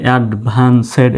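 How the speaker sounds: "অ্যাডভান্সেড" with a sounded "sed"; wrong pronunciation. Shown as a demonstration. Teacher warning learners against this reading, mispronounced "অ্যাডভান্সেড" as ad-van-sed. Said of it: "এই উচ্চারণটা কিন্তু এরকম নয়".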